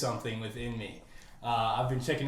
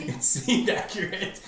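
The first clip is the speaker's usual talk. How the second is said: laughing; 4 semitones higher, slower than usual. Real, not acted. Laughing reply.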